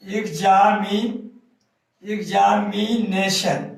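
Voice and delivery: Examination slowly, syllable by syllable; teacher stretching each syllable of examination; broken into syllables. Shown as a demonstration. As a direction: start examinee, pause, then sound out all of examination. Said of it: "examination को हम तोड़ेंगे".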